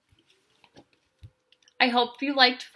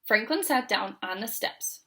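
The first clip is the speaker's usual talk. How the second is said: ordinary delivery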